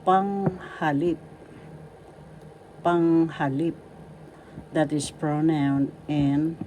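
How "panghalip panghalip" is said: "Panghalip" slowly, PANG held and LIP stressed; accent on first and third. Shown as a demonstration. Teacher introducing "Panghalip" to learners, stressed first and last syllables. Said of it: "and the third has the accent".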